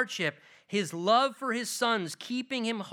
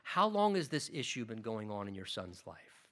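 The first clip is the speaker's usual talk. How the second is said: lower, softer, gently asking; a hint of a calm, caring Jesus; calm and compassionate; 9 semitones lower, 6 dB quieter than usual. A narrator's reading. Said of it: "Jesus, calmly with compassion".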